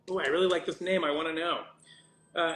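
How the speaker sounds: usual speaking voice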